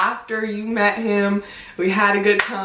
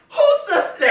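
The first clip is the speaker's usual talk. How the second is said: much higher laughing outburst; shocked mom; slower than usual. Retold or imitated. Mom on the phone hearing of a sister, high shocked laugh. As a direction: shriek it in disbelief. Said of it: "She was like".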